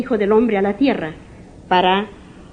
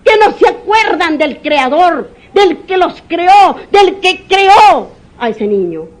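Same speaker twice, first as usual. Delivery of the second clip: louder, higher rebuke; fiery sermon cry; preaching shout; 7 semitones higher, 5 dB louder than usual. Real, not acted. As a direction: thunder at hard hearts from the pulpit. Preacher rebukes hard-hearted parents.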